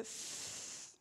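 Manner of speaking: "f" as a long voiceless rush of air; isolated phoneme. Shown as a demonstration. Teacher showing a rubbing gesture, "f" held as airy friction. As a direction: hold "f" as friction between teeth and lip. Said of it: "ça donne une idée de frottement".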